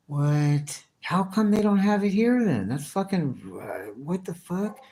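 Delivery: can't believe what he's hearing; amazed disbelief. Real, not acted. Stunned streamer asks the caller why it isn't sold here, high and disbelieving. Reacting to mushrooms in Mexico City.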